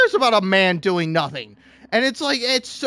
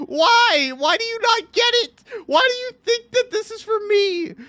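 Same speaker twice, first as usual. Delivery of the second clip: high despairing why, voice cracking up; exasperated cry; 12 semitones higher than usual. Real, not acted. Begging TikTok to understand, exasperated high whine. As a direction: throw up your hands and plead, pitched up.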